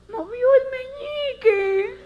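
high, slow tearful cry of joy; overjoyed cry. Retold or imitated. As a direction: cry it out like a therapist who sees a miracle. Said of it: "dice la fisioterapeuta".

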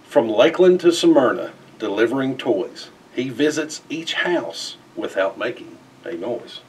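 plain reading voice

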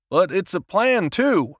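plain reading voice

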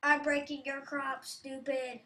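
normal talking voice

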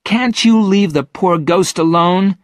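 put-on high, loud indignant girl's plea; angry, high-pitched protest; angry Virginia. A narrator's reading. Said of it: "was also angry with the twins".

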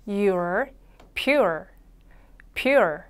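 "pure" said slowly as p plus your, the R curled back; 따라 하도록 보여주는 pure; model pure. Shown as a demonstration. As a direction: model "pure" three times for the class. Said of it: "한 번 더".

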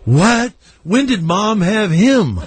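put-on shocked kid. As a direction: imitate a stunned child questioning Dad. Voicing his shocked young son.

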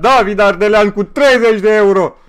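loud, high, hyped yell at the donation; excited shout. Real, not acted. Streamer shouting to his chat over a big donation, excited yell. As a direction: scream it out, thrilled by the money.

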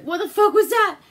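high, loud startled yell; a man spooked by a noise in the house, yelling in alarm; startled shout. Retold or imitated. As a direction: jump at the sudden noise and blurt it out. Guy startled by ape sound.

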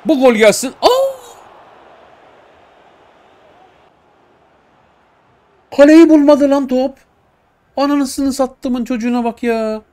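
shrieking high and loud at the miss; furious high-pitched outburst; anguished scream. Real, not acted. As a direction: scream in despair as the shot goes wide. Gamer screaming at missed goal.

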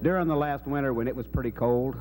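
usual speaking voice

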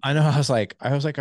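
usual speaking voice

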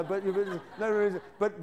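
ordinary talk

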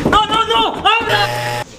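high-pitched frantic protest; panicked plea. Acted. Person left inside begs for the door to open, panicked. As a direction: beg urgently to be released. Trapped, begging to be let out.

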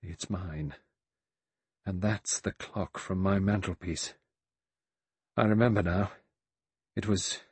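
hushed start, halting recollection; low, shuddering. A narrator's reading. Vera recognizes her clock, low and shuddering. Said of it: "said in a low, shuddering voice".

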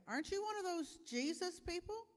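put-on a nosy girl calling him out; prying servant girl. Servant girl confronts Peter, high and pointed.

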